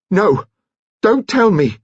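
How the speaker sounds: high, loud frightened cry; shouted no. Acted. Nurse stopping Kitty's confession, shouting high and loud. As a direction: cut her off, high and loud. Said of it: "she shouted".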